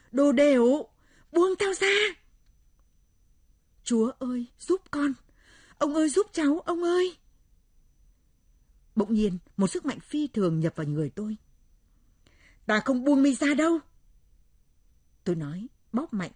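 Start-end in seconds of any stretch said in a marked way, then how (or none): 0.1-1.9 high, loud furious yell; frantic shriek. A narrator's reading. The possessed creature pinned underneath, shrieking frantically. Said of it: "miệng kêu thất thanh". 3.8-7.2 high, loud cry for help; desperate plea. A narrator's reading. Narrator wrestling a demon begs grandfather, desperate plea. 12.7-13.8 high, loud refusal; defiant shout. A narrator's reading. Narrator gripping the demon's wrists, shouting defiantly.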